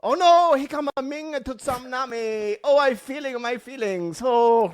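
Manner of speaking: very high, hammed-up mock Japanese accent; badly done Japanese accent.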